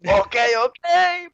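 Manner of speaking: slow, high Jamaican okay; Jamaican accent. Retold or imitated. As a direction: give in slowly in a Jamaican accent. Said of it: "In the thickest Jamaican accent".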